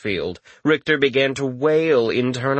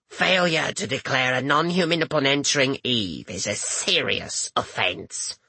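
put-on raspy stern old woman; rasping; 4 dB quieter than usual. A narrator's reading. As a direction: rasp it out coldly. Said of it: "the woman rasped out".

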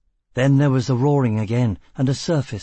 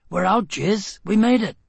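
higher, rising to a loud cheer; joyful excitement; 8 semitones higher, 3 dB louder than usual. Acted. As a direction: burst out with relief and triumph. Discovering they escaped the caves.

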